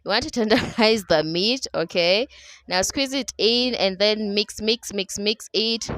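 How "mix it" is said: ordinary talk, nothing marked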